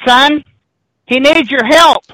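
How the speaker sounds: lightly voiced eerie old woman; a hint of a witchy old woman calling down; old lady's voice. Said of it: "the lady says".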